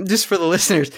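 normal talking voice

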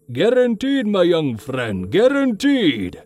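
put-on high, drawn-out salesman's boast in accented old-man voice; booming merchant. A narrator's reading. Old merchant promising a customer, hearty boom. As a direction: proclaim it with showy confidence, high-pitched. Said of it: "he boomed".

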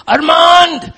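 desperate high, loud shout; scream. Retold or imitated. Father calling his buried son in the rubble, screamed. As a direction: cry the name out at full force. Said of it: "And he screamed".

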